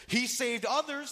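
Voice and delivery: loud, high, drawn-out jeer; scoffing. Acted. Onlookers jeer at Jesus on the cross, scoffing. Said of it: "they scoffed".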